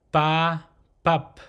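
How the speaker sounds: "ba" open with an unaspirated p, "pup" closed on the lips; unpuffed p starting "ba" and "pup"; soft P demonstration. Shown as a demonstration. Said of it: "This consonant is pronounced".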